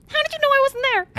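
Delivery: high, fast squirrel chatter. Acted. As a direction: talk like a panicky squirrel. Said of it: "the squirrel voice is too good".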